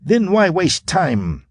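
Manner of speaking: high, slow furious demand; Narala fury. A narrator's reading. Narala urges Quarn to act, furious. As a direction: spit it out in fury. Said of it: "feline fury".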